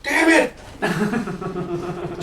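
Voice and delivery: high, loud squeaky curse with a laugh; a hammed-up squeaky grumble; old halfling cursing his snapped wheel, high and squeaky; annoyed old halfling. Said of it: "And he's like".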